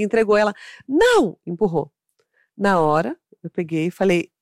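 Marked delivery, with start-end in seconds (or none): 0.9-1.4 put-on high, abrupt child's refusal; recusa birrenta imitada. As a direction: imitate a kid in a tantrum refusing. Child's angry refusal in story. (não agudo de criança birrenta)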